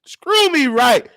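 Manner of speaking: high, mocking shouted put-down; mama roasting him. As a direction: cut him down like mama did. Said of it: "She told him".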